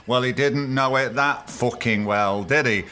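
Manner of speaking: ordinary talk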